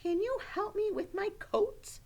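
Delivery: put-on high, clear polite child request; polite asking. Child looking at the teacher for coat help, asking politely. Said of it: "and asking politely".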